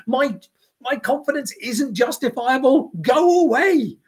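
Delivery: high, loud, offended rant; indignant outburst. Retold or imitated. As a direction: play the outraged specialist who won't be questioned. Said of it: "absolutely losing it".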